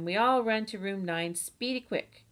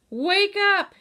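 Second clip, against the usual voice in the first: much higher, much louder shout; 13 semitones higher, 11 dB louder than usual. Acted. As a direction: yell it right into the baby's ear. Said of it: "and I shouted".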